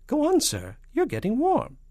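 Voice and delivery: very high, loud, encouraging spy; falsetto. A narrator's reading. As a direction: urge the boss on in falsetto. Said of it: "and a falsetto voice".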